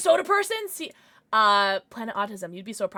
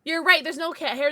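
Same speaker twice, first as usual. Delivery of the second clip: normal talking voice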